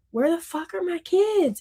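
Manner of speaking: imitating a desperate mother; retold panic. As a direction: replay the dream panic, voice jumping up. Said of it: "and I'm like".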